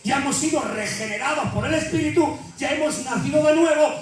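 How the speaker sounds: impassioned sermon peak; preaching at full force. Real, not acted. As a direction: lift the congregation with rising fervour.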